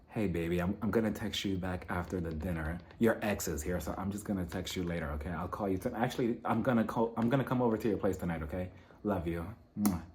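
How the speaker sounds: low, very soft pretend lover's call; hushed phone sweet-talk. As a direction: murmur it privately into the phone. Acted private phone call to a lover.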